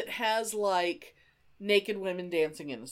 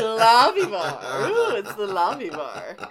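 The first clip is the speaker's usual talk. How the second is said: mock swooning; 6 dB louder than usual. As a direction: croon it like a giddy fan. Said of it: "we would just be like".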